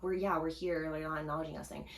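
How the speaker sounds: usual speaking voice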